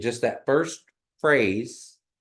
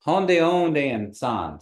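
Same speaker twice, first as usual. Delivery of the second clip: slow, louder, high start falling at the end; slow model phrase; 5 semitones higher, 5 dB louder, much slower than usual. Shown as a demonstration. Teacher combines two parts for the class, slow raised model phrase. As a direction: join both parts slowly for repeating. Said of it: "you're gonna combine the two".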